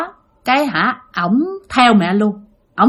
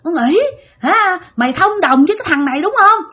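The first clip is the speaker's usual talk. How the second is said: high-pitched accusing scold; heavy cursing; 6 semitones higher than usual. Acted. Possessed mother accusing her friend of collusion, high angry cursing. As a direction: accuse a traitor in a raised pitch. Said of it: "Chửi quá trời luôn".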